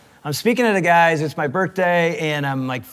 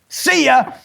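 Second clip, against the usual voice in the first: higher, louder gleeful "see ya"; eager farewell; 8 semitones higher, 4 dB louder than usual. Retold or imitated. Chaplain dashing off to his wife, gleeful and loud. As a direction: bolt out the door with glee. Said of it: "And I'm like".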